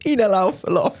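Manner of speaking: words squeezed out through high laughter; laughing. Real, not acted. Co-hosts joking about being engaged, laughing. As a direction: laugh as you say it, pitched well up.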